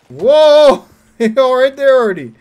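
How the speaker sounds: loud high exclamation; jolted by the surprise; surprised whoa. Real, not acted. Player spotting something suddenly, surprised shout.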